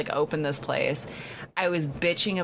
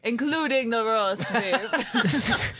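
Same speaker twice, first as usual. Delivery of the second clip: slow, higher words through laughter; laughing mock lament; 6 semitones higher, much slower than usual. Real, not acted. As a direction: drag it out as a laughing complaint.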